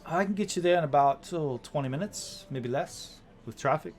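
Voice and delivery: a hint of an obliging cab driver; lightly played helpful sky coach pilot; polite young woman driver.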